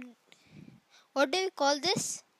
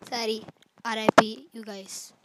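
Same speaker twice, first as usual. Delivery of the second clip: ordinary talk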